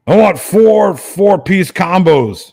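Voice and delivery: imitating a drunk friend ordering at the drive-through. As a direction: drag out the order like a hungry drunk.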